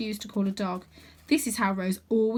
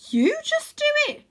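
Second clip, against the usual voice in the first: put-on much higher, louder girl voice; girl's retort; 6 dB louder than usual. A narrator's reading. Rose snaps back at Stella, high and cheeky.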